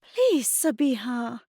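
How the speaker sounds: high, drawn-out sighing plea; exasperated sigh. A narrator's reading. Mum to arguing daughter, sighing plea. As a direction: beg her, worn out. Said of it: "Mum sighed".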